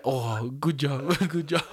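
imitated Vietnamese accent, breaking into laughter. Instructor praising his student on the phone, imitated Vietnamese accent. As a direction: congratulate in a mock Vietnamese accent, amused. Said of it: "thick accent".

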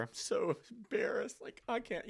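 high, slow, mock-mortified whine; lightly hammed-up embarrassment; embarrassed Ananias. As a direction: play the sheepish one caught out. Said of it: "imagine them going".

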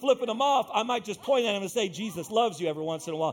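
high, driving sermon fervour; full-force preaching. Real, not acted. Preacher urging believers to sow seed, fervent preaching. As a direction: exhort the congregation with mounting energy.

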